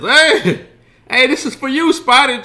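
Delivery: hammed-up loud wiseguy. Jimmy teasing Spider at the table, loud laughing shout. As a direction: bust his chops at full volume. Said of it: "And Jimmy says".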